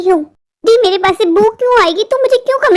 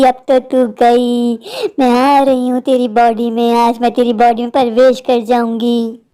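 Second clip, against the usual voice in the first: ghost voice; 7 semitones lower than usual. Acted. Ghost possessing girl speaks. (गहरी धीमी भूतनी की आवाज़)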